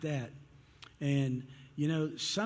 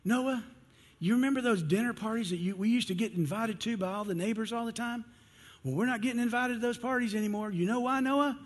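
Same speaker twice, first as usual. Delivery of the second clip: much higher, fast, exasperated wife; hammed-up upset wife, rushing her complaint; mock frustration, high and quick; 7 semitones higher than usual. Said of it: "she's all upset".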